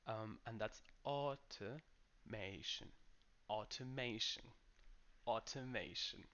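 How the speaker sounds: automation with AW at the start and small schwa syllables; pronunciation demo. Shown as a demonstration. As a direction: say automation as AW-tuh-MAY-shun, not ow. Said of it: "then we have a little schwa".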